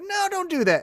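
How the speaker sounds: squeaky high plea to stop; mock high-pitched warning. Wrestler begging her not to, voiced high and squeaky. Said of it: "he's like".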